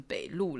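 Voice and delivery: 北路 with the accent on final 路; stress on second syllable. Shown as a demonstration. Teacher explaining the accent mark wins, final stress on 北路. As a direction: move the weight onto 路 in 北路. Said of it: "因为乌有撇重音了".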